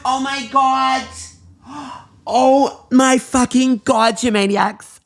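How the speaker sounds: high, drawn-out astonishment; slow, high-pitched shock; amazed. Acted. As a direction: gasp it out in disbelief. Realises the squirrels chose yes.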